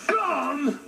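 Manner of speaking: a high offended echo; indignant bear. Acted. Bear insulted by the chipmunk, high indignant echo. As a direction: repeat the insult in disbelief.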